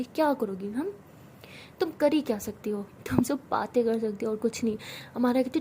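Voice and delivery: ordinary delivery, nothing marked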